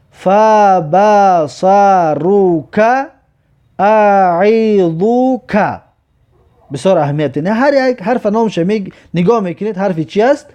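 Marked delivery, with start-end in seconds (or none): 0.1-5.8 فبصرک and اعیذک stretched slowly, each falling; slow Arabic reading. Shown as a demonstration. Teacher showing pupils the long words, slow recitation. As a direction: read the long words vowel by vowel.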